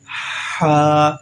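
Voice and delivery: Ha slightly stretched from the throat. Shown as a demonstration. Teacher showing students the stretch: slightly drawn-out Ha. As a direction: hold Ha a little, breathy. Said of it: "like this".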